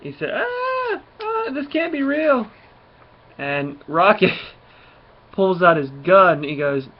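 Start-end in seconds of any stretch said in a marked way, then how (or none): 0.3-2.5 high-pitched, drawn-out wail of disbelief; mock panicked cry. Kid faced with a talking raccoon, mock panic. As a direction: play a freaked-out kid, voice shooting way up.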